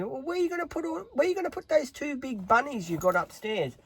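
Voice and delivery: high, fast mocking mum voice; mum asking. Mum asking where the bunnies will go, high fussing voice. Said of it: "my mum was saying to me".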